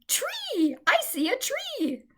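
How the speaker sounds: high, thrilled calling out; excited shout. A narrator's reading.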